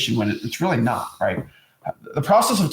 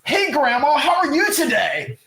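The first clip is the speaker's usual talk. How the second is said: high, sing-song sales voice to grandma; a phoney bright hello, pitched way up; fake chirpy greeting; 11 semitones higher, slower than usual. Mocking a salesman greeting grandma, high and sing-song. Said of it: "you don't talk like that".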